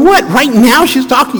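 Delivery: high, disbelieving question; incredulous. Retold or imitated. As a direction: react like you can't believe the hour. Incredulous at late call.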